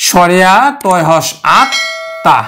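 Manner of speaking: slow spelled-out letters of আত্মা; spelling out the letters. Shown as a demonstration. Teacher spelling the word to students, slow and deliberate. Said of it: "তার উচ্চারণ আমরা করব কিন্তু বাংলা".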